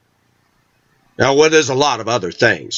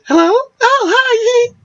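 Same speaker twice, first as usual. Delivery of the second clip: mother's fake sugary phone greeting, very high; mocking his mother's high phone voice; sweet phone voice; much slower than usual. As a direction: switch to a syrupy high hello.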